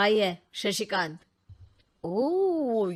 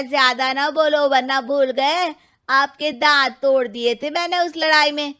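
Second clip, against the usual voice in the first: angry threat; 5 semitones higher, 7 dB louder than usual. Acted. Brothers quarrel.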